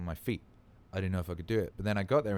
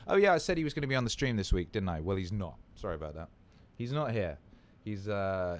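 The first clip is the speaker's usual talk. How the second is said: ordinary talk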